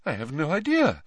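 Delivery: affronted seer. A narrator's reading. Voices Trelawney in story.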